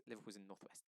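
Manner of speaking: ordinary talk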